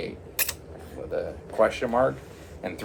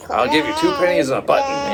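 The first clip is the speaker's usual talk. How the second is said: high, loud mock haggling; haggling offer; 8 semitones higher, 6 dB louder, faster than usual. Broke man offering pennies for the silk, haggling. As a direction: pitch a stingy deal, voice raised. Retold lowball offer for a refund.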